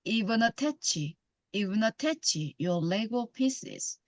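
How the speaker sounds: very slow, "even attach" as "ivenatachi"; linked "even attach". Shown as a demonstration. Teacher showing learners linked pronunciation, in a very slow linked "even attach". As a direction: glide "even" straight into "attach". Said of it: "이거 붙여서 발음되죠".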